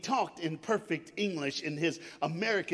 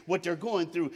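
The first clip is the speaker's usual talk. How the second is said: ordinary talk, nothing marked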